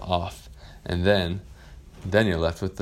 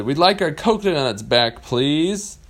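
put-on higher, louder kids asking back; polite demand; 8 semitones higher, 6 dB louder, slower than usual. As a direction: ask firmly but politely. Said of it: "they said".

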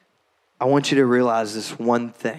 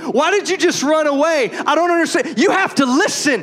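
angry scolding dad; 12 semitones higher than usual. Retold or imitated. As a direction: berate a lost child. Dad scolding lost child.

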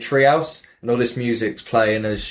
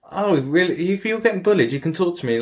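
mock concerned neighbour; 8 semitones higher than usual. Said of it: "And it's like".

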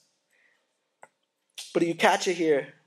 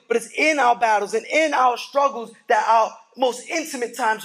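preaching shout; 5 semitones higher, 6 dB louder than usual. Acted. Pastor rallying the church about struggles, shouting. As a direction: lift it into a full shout.

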